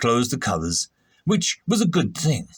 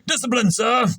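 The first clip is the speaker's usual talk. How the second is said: ordinary delivery, nothing marked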